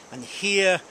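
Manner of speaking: ordinary delivery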